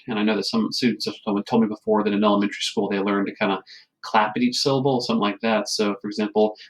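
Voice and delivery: ordinary delivery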